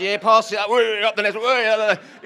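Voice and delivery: loud, high carefree whee; breezy Uncle Dan. Retold or imitated. Mimicking Uncle Dan shimmying up the scaffold, loud breezy whoops. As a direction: toss it off like it's nothing.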